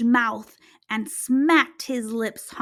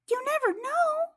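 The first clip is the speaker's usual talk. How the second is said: squeaky, small and hopeful; high tiny mouse voice; 12 semitones higher, 3 dB quieter than usual. Acted. Mouse offering help to the laughing lion, high and squeaky.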